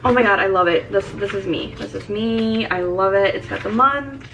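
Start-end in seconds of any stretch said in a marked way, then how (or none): none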